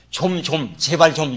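ordinary talk

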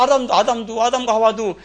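ordinary talk

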